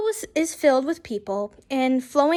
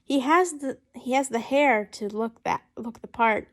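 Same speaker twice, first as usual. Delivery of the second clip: ordinary delivery, nothing marked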